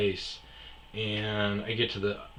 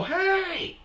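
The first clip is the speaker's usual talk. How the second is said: high shouted hail; calling out; 3 dB louder than usual. Retold or imitated. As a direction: get the driver's attention. Calling out again.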